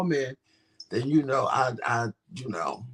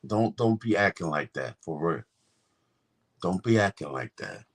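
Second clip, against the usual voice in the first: lowered, laid-back street talk; put-on deep voice; 6 semitones lower than usual. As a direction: play it low and cool. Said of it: "you ain't talking normal".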